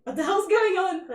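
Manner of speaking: loud high startled outburst; confused shout. Acted. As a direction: blurt it out baffled. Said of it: "you're like".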